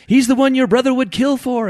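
high, loud little-creature proclamation; echoing shrimp. A narrator's reading. Shrimp tells Ed of his brother's wish, proclaiming. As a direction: echo it fervently, high and loud. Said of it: "echoed another".

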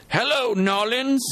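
put-on high, drawn-out Creole-accented call. A narrator's reading. Boy hails New Orleans from the hilltop, mock Creole accent. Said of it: "in his best Creole accent".

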